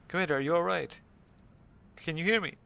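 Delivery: high, loud, urgent hail; frantic radio operator calling for a reply; Mei Ling, frantic. Acted. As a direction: call out in alarm, pitch soaring. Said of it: "Mei Ling frantically hailing me".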